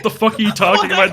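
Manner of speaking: loud, laughing "what are you on about"; mock rude snap in a possible future; incredulous outburst. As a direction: blurt it out, half laughing. Rude vision branch voice.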